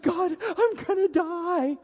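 very high, drawn-out, hammed-up cry; mock panicked wail. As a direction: wail to God in exaggerated terror. Acts out panicked believers crying to God.

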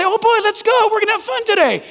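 high excited cartoon voice; cheery Pooh friends. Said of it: "Christopher Robin and Winnie the Pooh".

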